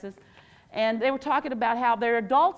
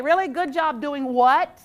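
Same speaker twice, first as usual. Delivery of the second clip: normal talking voice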